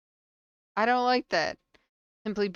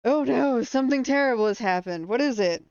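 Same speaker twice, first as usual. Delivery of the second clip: loud, higher-pitched dismay; alarmed reaction; 5 semitones higher, 8 dB louder than usual. Real, not acted. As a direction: react loudly to terrible news. Reacts to bad news in game.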